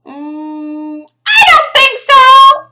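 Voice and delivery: high, drawn-out doubtful "Mm, I don't think so"; a dubious viewer refusing to believe it; sceptical head-shake. Pretend. Imagined viewer shaking head at film.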